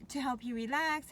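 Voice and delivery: usual speaking voice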